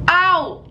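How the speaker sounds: quick high yelp of pain; sharp ow of pain. Real, not acted. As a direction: yelp as the brush scratches. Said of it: "I literally scratched myself".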